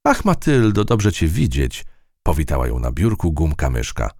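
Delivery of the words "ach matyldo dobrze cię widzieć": high, fond welcome; high warm greeting; eraser greeting. A narrator's reading. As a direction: glad to see an old friend.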